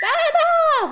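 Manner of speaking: high shouted baa; sheep bleat. Pretend. Mom teasing the toddler with a high sheep bleat. Mother mocking sheep for frog.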